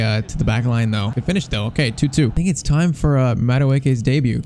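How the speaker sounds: usual speaking voice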